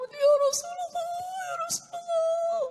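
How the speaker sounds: high, drawn-out mock sobbing cry; tearful wailing. Drunk man overcome at the Prophet's message, tearful wail. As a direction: wail it out as if weeping, voice pitched high. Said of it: "Dia nangis".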